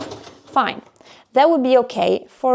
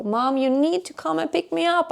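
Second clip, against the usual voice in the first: put-on pleading child; 6 semitones higher, 4 dB quieter than usual. Daughter begging to be picked up.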